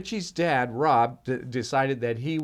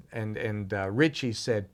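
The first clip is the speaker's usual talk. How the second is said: plain reading voice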